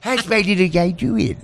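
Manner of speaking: slow doting coo; gentle dog-talk. Retold or imitated. Host imitating Dan talking to the dog, slow cooing. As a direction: draw it out like baby talk to a pet. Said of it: "and then here's Dan".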